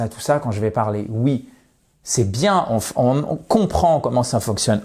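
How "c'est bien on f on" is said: ordinary delivery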